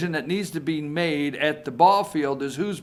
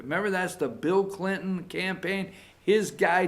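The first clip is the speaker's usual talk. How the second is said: normal talking voice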